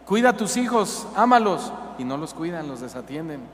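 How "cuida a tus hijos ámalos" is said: voice of God. Acted. God's commands voiced.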